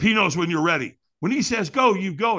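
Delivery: ordinary talk, nothing marked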